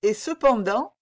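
firm but sorrowful, mildly played; gentle, sad firmness. A narrator's reading. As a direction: put on a gently sorrowful resolve. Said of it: "en affectant une douce et triste fermeté".

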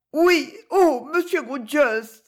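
high, slow heartfelt cry; emotional young lady's high appeal; overcome exclamation. Acted. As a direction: burst out high and loud, then draw out the name. Rosa exclaims to her rescuer.

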